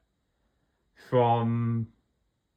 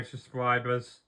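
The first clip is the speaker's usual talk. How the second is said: ordinary delivery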